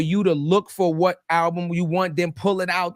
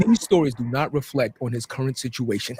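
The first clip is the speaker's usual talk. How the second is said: announcer voice; 5 semitones lower than usual. Shown as a demonstration. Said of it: "let me do my announcer voice".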